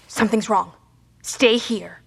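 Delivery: usual speaking voice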